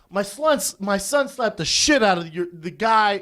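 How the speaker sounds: high, loud worked-up father; mad dad. Retold or imitated. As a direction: imitate dad complaining to the manager. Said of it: "My dad's so mad".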